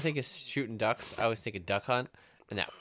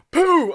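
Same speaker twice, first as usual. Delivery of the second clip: high, loud imitated rifle crack; mock gunshot; 15 dB louder than usual. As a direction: spit out the gunshot sound, much higher and louder. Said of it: "'cause they were like".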